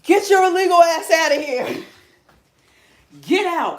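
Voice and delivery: loud, high, hammed-up yell; mock Trump shout. Imagined Trump yelling at migrants, hammed-up shout. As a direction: bellow it like a furious president. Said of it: "You wish Trump could just come in and be like".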